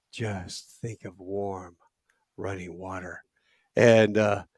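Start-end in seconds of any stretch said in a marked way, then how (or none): 0.1-3.2 slow, soft, soothing voice; calm him down, very slowly; soothing hush. Retold or imitated. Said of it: "she goes".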